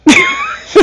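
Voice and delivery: a sudden shrieking laugh that leaps way up; high squealing laugh. Real, not acted. Host squeals with laughter retelling the wife's question.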